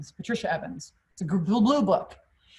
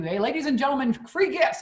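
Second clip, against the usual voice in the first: high, bright barker's call to the shoppers; store pitch announcer; 6 semitones higher than usual. Retold or imitated. Salesman calling shoppers over to the stage, high barker call. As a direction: reel the crowd in like a sales demo man.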